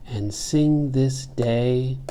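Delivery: "And sing this day" slow, stretched, held before the break; pause at caesura. Shown as a demonstration. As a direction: scan "And sing this day" deliberately and stop after "day". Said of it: "there's the caesura".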